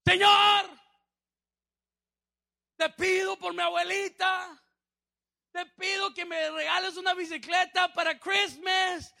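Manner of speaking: put-on high, loud shouted child's prayer; praying at full voice. Boy praying so his grandmother hears about the bicycle, at full voice. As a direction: holler the prayer, drawing out the first word. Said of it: "a toda su voz".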